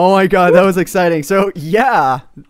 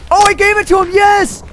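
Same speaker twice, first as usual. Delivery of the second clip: high excited relief; 14 semitones higher, 3 dB louder than usual. Real, not acted. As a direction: squeal of relief. Passed the potato away.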